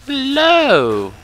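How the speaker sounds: loud, high, drawn-out hello; big stretched greeting shout; calling out. Real, not acted. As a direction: holler it high and let the pitch slide down. Calls out to more enemies.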